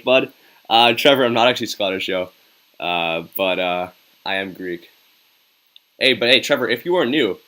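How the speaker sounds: normal talking voice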